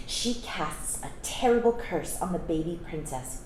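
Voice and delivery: usual speaking voice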